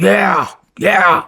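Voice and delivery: high, loud put-on voice; imitating his buddy. The buddy answering a call under fire, high and loud mock voice. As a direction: toss it back high and loud. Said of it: "He's like".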